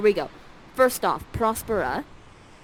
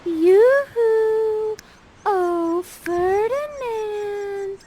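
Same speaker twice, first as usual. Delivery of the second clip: much higher, sing-song call; flirty Miranda; 12 semitones higher, 5 dB louder, much slower than usual. Acted. Miranda distracting Ferdinand from stacking wood, high flirty call. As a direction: coo his name slowly, flirting. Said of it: "Miranda goes up to Ferdinand and says this".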